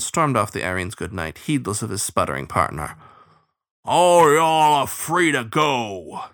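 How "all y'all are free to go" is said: loud, slow, high hound bay; bay it out across the deck; drawn-out disgusted howl. A narrator's reading. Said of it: "he bayed, disgusted".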